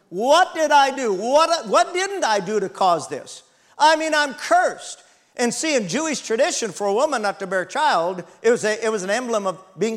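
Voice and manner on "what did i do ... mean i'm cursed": high, loud, slow despairing cry; anguished lament. Acted. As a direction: voice a woman crushed by guilt. Hannah's anguished self-questioning.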